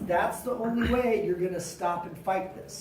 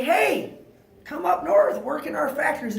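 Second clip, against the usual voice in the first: high, louder recruiting call; 7 semitones higher, 5 dB louder than usual. Retold or imitated. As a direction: call the workers north like a recruiter. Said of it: "And we say".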